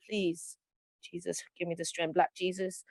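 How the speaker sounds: exasperated prayer aside. Real, not acted. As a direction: drop the voice and beg heaven for strength.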